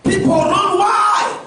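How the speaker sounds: loud, high preaching with a ringing call; fiery preaching. Acted. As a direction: preach it hard to the congregation. Preaching at full force.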